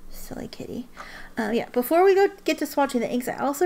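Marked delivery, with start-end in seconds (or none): 0.1-0.9 soft whisper to the cat; whispered aside. Real, not acted. Owner whispering fondly to her cat. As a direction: breathe it to the kitty.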